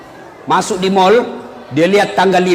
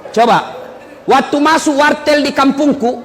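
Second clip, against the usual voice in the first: ordinary talk, nothing marked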